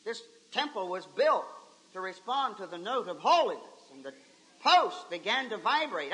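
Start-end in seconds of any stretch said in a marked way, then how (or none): none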